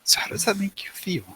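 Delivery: soft, whispered therapist question; hushed therapy-session voice; mock therapist. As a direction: half-whisper it like a probing therapist. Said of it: "is this a fucking therapist session".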